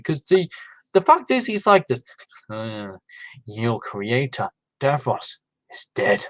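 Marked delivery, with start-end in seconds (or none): 2.5-6.2 slow, soft, low Scottish McCoy lilt, lightly done; a hushed Scottish Doctor line, drawn out; Sylvester McCoy accent. Retold or imitated. Imitating the Doctor's Scottish accent to viewers, slow and hushed. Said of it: "my Sylvester McCoy accent".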